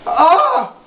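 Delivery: sudden high loud yelp; shocked squeal; high startled yelp. Retold or imitated. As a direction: yelp like something just hit you. Kid hit by goober in stall.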